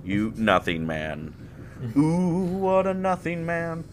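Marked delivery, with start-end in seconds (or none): 1.9-3.8 higher teasing coo; mock swoon. Teasing a friend with a high mock coo. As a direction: croon it to tease.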